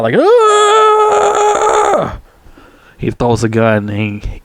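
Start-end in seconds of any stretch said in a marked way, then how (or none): 0.2-2.2 long, very high-pitched scream; imitated loud scream. Retelling Rambo shooting up the machinery, imitated scream. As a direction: act out a drawn-out battle scream. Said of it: "He starts screaming loud".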